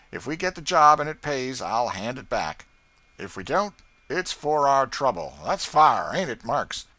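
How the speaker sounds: rough, loud, pushy bargaining voice; gruff slave catcher. Acted. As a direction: lean on him for the money, loudly. Tom Loker bargaining with Haley.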